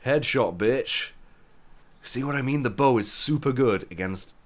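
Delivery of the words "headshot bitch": excited. Real, not acted. Lands a headshot.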